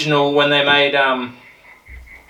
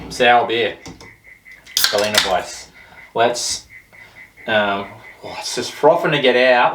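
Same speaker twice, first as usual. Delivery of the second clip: ordinary delivery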